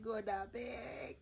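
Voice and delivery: high, slow drawn-out plea; whining plea. Retold or imitated. Exhausted corps member begs official, high slow whine. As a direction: stretch it out like a tired child. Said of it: "I said".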